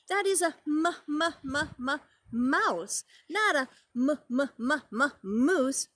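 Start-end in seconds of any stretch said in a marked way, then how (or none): 0.9-3.0 "mouse" after separate, repeated "m" sounds; first sound repeated. Shown as a demonstration. Teacher naming the animal for preschoolers, first sound repeated. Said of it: "M words". 4.3-5.9 "moose" after separate, repeated "m" sounds; first sound repeated. Shown as a demonstration. Teacher sounding out a word for preschoolers, first sound repeated. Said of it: "M words".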